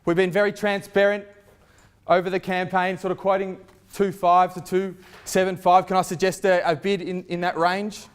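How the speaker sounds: rally the bidders, full voice; auctioneer. Acted. Calling for bids at auction.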